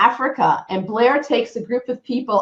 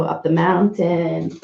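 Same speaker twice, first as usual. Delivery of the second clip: normal talking voice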